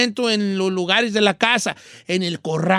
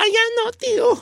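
niece's voice; 11 semitones higher than usual. Retold or imitated. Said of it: "Y le digo".

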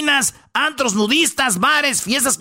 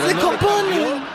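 riendo; 7 semitones higher, slower than usual. Real, not acted. Repite la frase riendo.

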